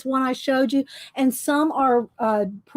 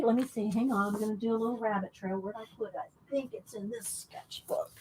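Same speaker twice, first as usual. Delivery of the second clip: murmured, much softer, dropping almost to a whisper; quiet self-talk; 12 dB quieter than usual. Real, not acted. Host hunting through sketchbooks for notes, murmuring to self. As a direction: think aloud under your breath. Talks to self while searching.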